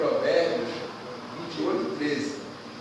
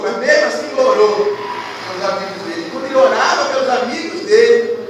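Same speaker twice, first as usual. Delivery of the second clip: preaching at full force; 5 semitones higher, 10 dB louder than usual. Acted. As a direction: drive the blessing home to the church. (pregação inflamada, em voz alta)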